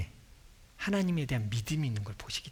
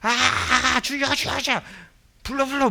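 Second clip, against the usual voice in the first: much higher, louder mock crying out "주여"; frantic mock prayer; 10 semitones higher, 7 dB louder, slower than usual. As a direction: ham up a desperate shouted prayer. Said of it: "그냥".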